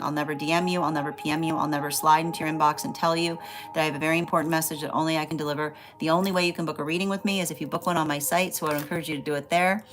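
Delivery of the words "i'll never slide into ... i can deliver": ordinary delivery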